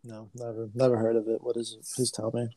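low, soft deadpan fake ignorance; teasing his friend by pretending he's never heard of it, low deadpan; sarcastic denial. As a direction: play dumb, dripping sarcasm. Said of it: "you're fucking lying".

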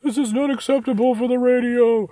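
high, loud outraged producer; angry radio producer. Retold or imitated. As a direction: squeal it like an exasperated radio boss. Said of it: "some radio producer somewhere whose head is exploding".

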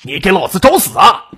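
loud, high angry shout; furious roar. A narrator's reading. Robber lashing out at a woman who resisted, roaring. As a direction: roar it as an enraged thug. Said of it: "怒吼道".

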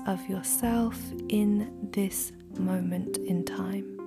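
soft whisper, close to the mic; right in your ear. Acted. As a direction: breathe the words gently into the ear.

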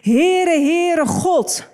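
God calling out his own name on the mountain, proclaimed loudly; proclaiming God's name. Acted. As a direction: announce the Lord's name with full force. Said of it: "dus dit is God die spreekt".

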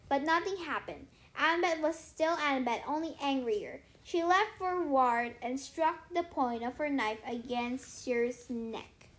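usual speaking voice

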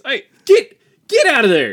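sharp high shout to shoo someone off; yelling get out. Acted.

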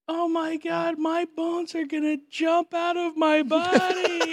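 put-on high-pitched child's wail, stretched out; mock terrified scream. Imitating a daughter waking from a nightmare, mock terrified wail. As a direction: shriek it slowly like a panicked little girl. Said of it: "screaming, terrified".

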